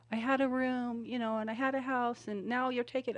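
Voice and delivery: mother echoing her daughter's hurt words, tearful; tearful quote. Retold or imitated. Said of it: "Yeah, I cried".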